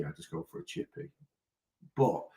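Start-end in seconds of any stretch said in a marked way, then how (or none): 0.0-1.1 quiet aside. Retold or imitated. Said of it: "I wouldn't call it a chippy tea".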